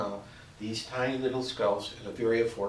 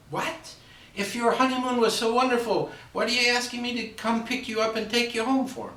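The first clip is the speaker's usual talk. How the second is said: much higher, louder incredulous mother; shocked mother; 12 semitones higher, 4 dB louder than usual. Acted. Mother asking why her daughter wants to come home, high and shocked.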